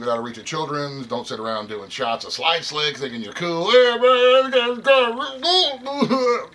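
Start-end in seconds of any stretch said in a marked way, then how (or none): 3.7-6.5 much higher, louder, slow goofy dude voice; mocking a guy who drinks gun lube, goofy high voice; mock dumb dude. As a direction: ham up a clueless show-off. Mocking someone doing shots of lube.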